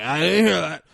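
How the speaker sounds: hammed-up wail to block out the words; mock refusal to hear. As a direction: imitate a coach loudly not listening. Exaggerating coach's wilful deafness.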